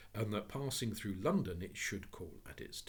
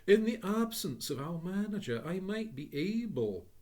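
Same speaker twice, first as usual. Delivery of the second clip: higher, louder courteous voice; polite clerk; 11 semitones higher, 9 dB louder than usual. Acted. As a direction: business voice to a customer. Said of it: "addressing a valued customer".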